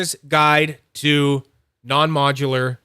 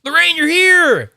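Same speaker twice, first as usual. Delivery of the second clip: high, loud, drawn-out hello; delighted to see Lorraine; excited greeting; 14 semitones higher, 9 dB louder than usual. Real, not acted. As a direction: greet a friend who just showed up. Greeting viewer who joined.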